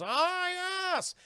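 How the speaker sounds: high mock grand agreement; Bane voice. Bane agrees to the plan, high and grand. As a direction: agree like a pompous henchman. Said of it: "He goes".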